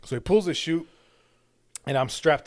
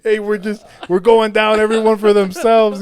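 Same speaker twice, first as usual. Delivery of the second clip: high, loud frantic announcement; imagined pilot shouting loud and high; mock panic shout; 11 semitones higher, 16 dB louder than usual. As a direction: yell it like the plane's going down. Imagined pilot abandoning plane.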